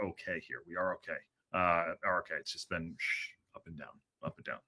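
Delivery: drained, very soft and low; low energy. Real, not acted. Host updating viewers on sleepless fire alerts, real low energy. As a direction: let it sink away, worn out from lack of sleep. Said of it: "Sorry for the lack of energy".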